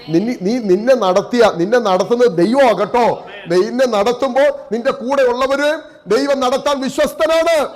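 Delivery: climbing to a high, ringing preaching pitch; impassioned exhortation. Real, not acted. Preacher assuring a believer that God will lead, rising impassioned exhortation.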